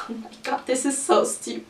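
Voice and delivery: breathy laugh through the words; giggling, embarrassed at the memory. Real, not acted. Laughing at her own story.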